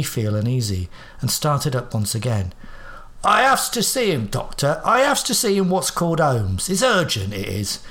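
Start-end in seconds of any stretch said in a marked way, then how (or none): 3.2-7.8 croaked with vehemence. Acted. Old man pleading urgently to see Holmes, croaking. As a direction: croak it out, insisting hard. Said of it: "he croaked out with vehemence".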